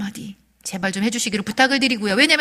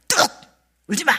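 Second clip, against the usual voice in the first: mock stern order; 7 semitones higher than usual. Parent ordering crying boy.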